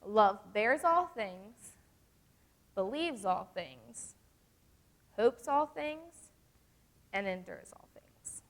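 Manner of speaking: slow, stressing each all things; slow emphatic reading of the last part. Shown as a demonstration. Youth leader rereading the verse slowly to the girls, emphatic. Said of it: "let's just read this last part".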